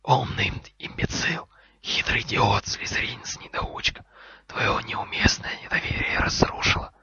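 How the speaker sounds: stream of hissed insults; angry snake hiss. Acted. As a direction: spit the words out in a forceful whisper. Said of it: "Змея шипела так сердито".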